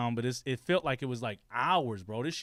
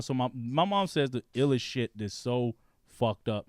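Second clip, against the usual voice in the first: normal talking voice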